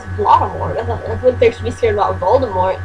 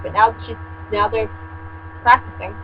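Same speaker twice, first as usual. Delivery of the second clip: normal talking voice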